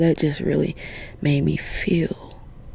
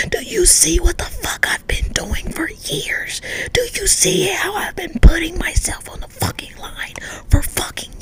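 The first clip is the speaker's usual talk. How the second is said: forceful, indignant stage whisper; angry whisper; 5 dB louder than usual. Real, not acted. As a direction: whisper it hard, demanding to be appreciated. Whispered rant at those who disrespect.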